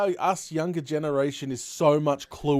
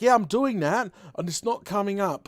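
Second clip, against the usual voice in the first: mock playing along; 6 semitones higher, 3 dB louder than usual. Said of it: "she'd be like".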